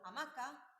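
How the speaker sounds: "hamaca" with the stress on the middle "ma"; stress on second syllable. Shown as a demonstration. Teacher giving an example of prosodic accent, "hamaca" stressed on "ma". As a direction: lean on "ma" in "hamaca". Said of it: "recae en la sílaba ma".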